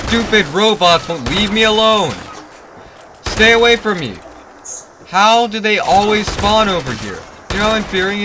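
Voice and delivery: loud, high furious yell at the robots; angry yelling. Real, not acted. Gamer yelling at robots spawning at base, angry shout.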